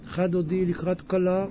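low, soft mumbled prayer; mock lifeless synagogue murmur; barely moving lips. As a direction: imitate praying without moving the lips. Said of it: "הוא לא מזיז את השפתיים אפילו".

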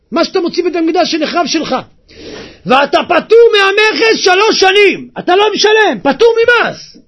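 high, loud, insistent persuasion; loud, high-pitched urging; shouted retold offer. Officials' offer acted at full volume.

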